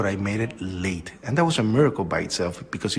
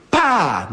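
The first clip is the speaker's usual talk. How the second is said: loud, higher burst of explosion noise; imitated impact bang; 8 semitones higher, 5 dB louder than usual. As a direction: punch out the sound of the blast. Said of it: "The impact and the explosion".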